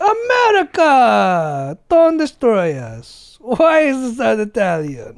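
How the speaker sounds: put-on high, loud, slow wailing plea, Italian-sounding accent; mock foreign accent. Frightened locals pleading with the American, Italian-sounding accent. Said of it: "Accents are hard for some people".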